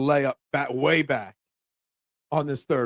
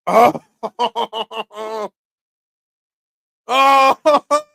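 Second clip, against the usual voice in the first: excited shout; 3 semitones higher, 7 dB louder than usual. Real, not acted. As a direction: yell out in real excitement. Shot lands near the pin.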